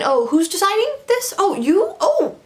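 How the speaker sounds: fake-surprised, mocking; mock surprise. Sarcastic mock questions.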